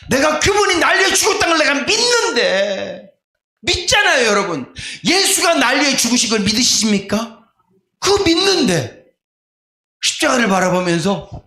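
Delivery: high, ringing preacher's appeal; preaching at full force. Acted. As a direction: press the church to answer. Preacher pressing congregation.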